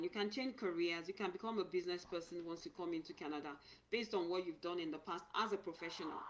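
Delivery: ordinary talk, nothing marked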